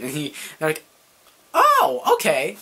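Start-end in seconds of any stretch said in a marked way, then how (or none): 1.5-2.6 high, loud, slow drawn-out surprise; surprised oh okay. Retold or imitated. Friends catching on that Eric likes guys, a high drawn-out mock surprise. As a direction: drag it out in mock realization. Friends react to his hint.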